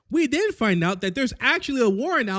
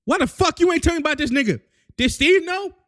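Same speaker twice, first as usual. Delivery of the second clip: fast, loud, high accusing rant; grilling; 8 semitones higher, 5 dB louder than usual. Acted. Sam grilling Bucky about the secret soldier, fast loud accusing. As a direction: hammer him with questions. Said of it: "Sam starts grilling the shit out of Bucky".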